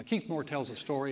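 normal talking voice

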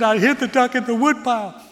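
loud, high tearful wail; mock weeping. Boy confessing where the duck is, mock weeping. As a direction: bawl out the hammed-up confession. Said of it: "He starts to weep".

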